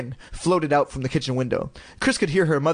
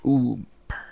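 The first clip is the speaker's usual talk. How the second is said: whispered aside; 3 semitones lower than usual. Real, not acted. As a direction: breathe it as a sly aside. Narrator aside.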